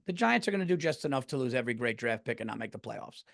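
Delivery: usual speaking voice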